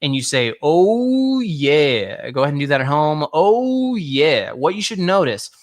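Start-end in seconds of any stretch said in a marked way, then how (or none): 0.6-2.3 chest register demo. Shown as a demonstration. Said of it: "it resonates in your mouth". 3.3-4.5 long high "oh" falling to "yeah"; swooping, resonant "oh yeah"; chest register demo. Shown as a demonstration. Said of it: "it resonates in your mouth".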